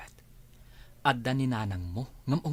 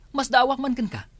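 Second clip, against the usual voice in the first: high, loud angry outburst; angry shout; 11 semitones higher, 6 dB louder than usual. Acted. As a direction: yell it back at her in the fight. Husband shouting in quarrel. (nagpungtot nga ikkis)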